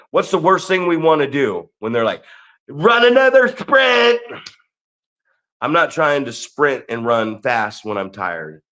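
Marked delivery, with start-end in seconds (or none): 2.7-4.2 high, loud, drawn-out holler; imitated drill shout, stretched out; mock coach yell. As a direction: bellow it like a coach driving tired athletes. Imitates coach ordering sprints.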